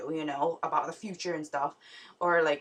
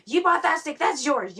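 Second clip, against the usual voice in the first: higher, louder scolding dad voice; yelly dad; 8 semitones higher, 6 dB louder than usual. Retold or imitated. Dad yells at daughter about her steak, loud and scolding. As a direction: yell it like an annoyed father. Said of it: "he was all yelly and stuff".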